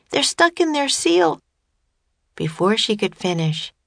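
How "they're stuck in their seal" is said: put-on high, alarmed child's voice; crying out. A narrator's reading. Annie explaining why their friends can't help, crying out. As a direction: exclaim it high and urgent. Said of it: "cried Annie".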